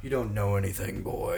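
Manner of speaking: low condescending voice. Acted. Mock condescension to friend.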